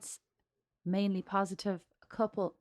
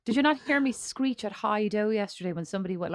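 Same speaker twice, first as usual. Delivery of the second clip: usual speaking voice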